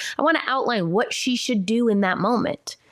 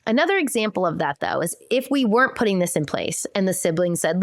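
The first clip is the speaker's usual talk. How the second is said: ordinary delivery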